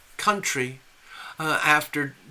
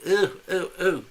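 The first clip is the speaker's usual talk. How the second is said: mock disgusted groans; 3 semitones higher than usual. Mocking British disgust.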